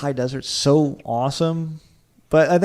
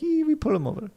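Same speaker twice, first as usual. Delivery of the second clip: high, hammed-up Italian cop accent; mock Italian accent; 4 dB quieter than usual. Italian traffic cop deciding to pull a driver over, in a hammed-up Italian accent. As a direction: play a jokey Italian police officer waving a driver over. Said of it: "That's a very German accent".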